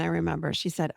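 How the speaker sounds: ordinary delivery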